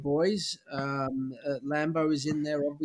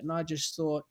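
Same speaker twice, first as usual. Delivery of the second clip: normal talking voice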